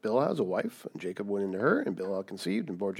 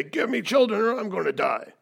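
higher, pushy mocking demand; a hint of mock whining demand; 9 semitones higher, 4 dB louder than usual. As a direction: echo her nagging mockingly. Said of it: "You don't come up to me and say".

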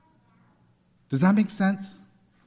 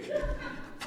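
breathy laugh; 12 dB quieter than usual. Real, not acted. Laughs at own thought.

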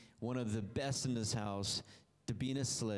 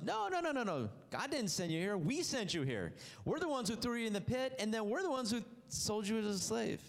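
protesting brothers; 8 semitones higher than usual. Acted. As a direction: cry out like guilty brothers who can't believe it.

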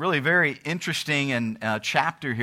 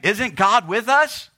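high, loud, drawn-out question climbing at the end; oblivious disbelief; 7 semitones higher, 6 dB louder, slower than usual. Acted. Preacher voices Israel still expecting God, loudly bewildered. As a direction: ask it in raised, acted bewilderment.

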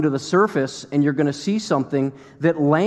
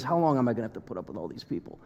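weary muttered complaint; 5 semitones lower, 9 dB quieter, much faster than usual. Retold or imitated. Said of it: "we get an idea when he says".

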